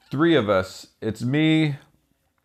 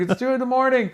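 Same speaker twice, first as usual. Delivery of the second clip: mock cabbie, much higher, refusing; cab driver refusing; 13 semitones higher than usual. Cab driver refusing the drunk passengers, mock high voice. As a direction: play the stubborn driver, pitched way up. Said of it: "yelling and fighting".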